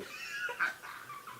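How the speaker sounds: wheezing squeal of laughter; high airless laugh. Real, not acted. Squealing laugh after quoting his tearful friend.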